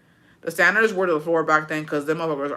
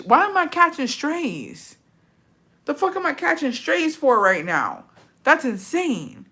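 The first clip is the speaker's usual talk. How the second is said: high, slow indignant whine; who, me? outrage; indignant disbelief; 8 semitones higher, slower than usual. Real, not acted. Outraged at stranger's insult.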